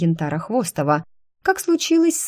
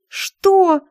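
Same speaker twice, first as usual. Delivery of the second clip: high startled yelp; shocked shout; 13 semitones higher, 5 dB louder than usual. Acted. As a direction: shout it in disbelief. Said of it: "Ну зачем же так кричать?".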